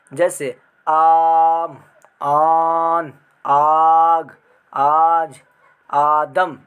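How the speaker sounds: آم and آن stretched long and loud, slow; drawn-out long a. Shown as a demonstration. Teacher shows students how the madd vowel is stretched. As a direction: pull the long آ out in آم آن آگ آج آدم. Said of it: "اسے کھینچ کر پڑھا جاتا ہے".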